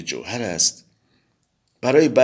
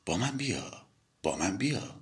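hushed and much softer; آهسته و نجواگونه; softly; 10 dB quieter than usual. A narrator's reading. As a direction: coax him close, barely above a breath. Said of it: "و آهسته گفت".

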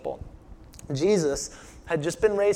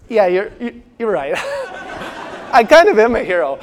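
louder, laughing mock-cocky agreement; a laughing, hammed-up brag; cocky hero, laughing; 3 semitones higher, 9 dB louder than usual. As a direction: play the smug hero who takes the praise. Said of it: "was like".

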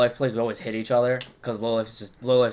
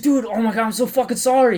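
high, urgent mock apology; hammed-up remorse, pitched way up; panicked apology; 10 semitones higher, 4 dB louder than usual. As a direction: imitate yourself apologizing in a fluster. Acting out panicked apology to bandmate.

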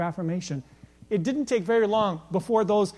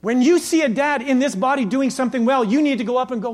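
loud, high preacher push; full-force preaching; 8 semitones higher, 9 dB louder than usual. Acted. Pastor urging the church, preaching loudly. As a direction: urge the men on.

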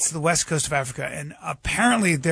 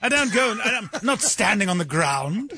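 haughty English aristocrat, higher pitch; mock English accent; 5 semitones higher than usual. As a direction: put on a snooty English hunter. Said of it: "Is that why you're doing the accent?".

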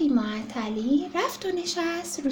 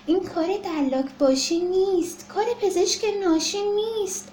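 usual speaking voice

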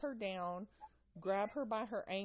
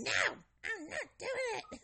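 put-on defiant child; 5 dB louder than usual. Daughter refusing medicine.

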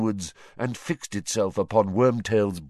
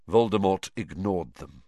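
usual speaking voice

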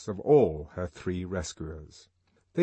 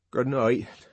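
choked, tight louder goodnight; strangled voice; 4 semitones higher, 6 dB louder than usual. Acted. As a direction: force it out, strangled. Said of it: "said a strangled voice behind her".

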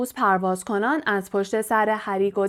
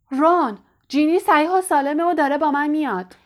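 higher, louder call down the tunnel; shouting; 6 semitones higher, 4 dB louder than usual. A narrator's reading. Harry shouting to Ron through the tunnel, loud call. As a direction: call out to Ron far ahead. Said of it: "فریاد زد".

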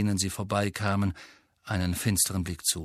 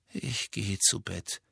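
weary; 5 dB quieter, slower than usual. Acted. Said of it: "erhob sich langsam".

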